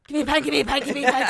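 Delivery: loud, high, urgent repetition; frantic demand. Retold or imitated. Wired daughter demanding a pen from mum, frantic. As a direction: imitate someone wired and shouting to be handed something.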